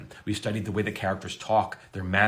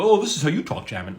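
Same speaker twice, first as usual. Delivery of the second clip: mocking imitation; 7 semitones higher, 4 dB louder than usual. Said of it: "They go".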